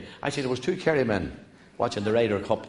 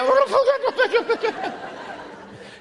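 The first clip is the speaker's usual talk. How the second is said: high, loud helpless laughter; high cackling laugh; 5 dB louder than usual. Real, not acted. Comedian collapses laughing at his own golf joke. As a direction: break down laughing mid-story. Teller cracks up.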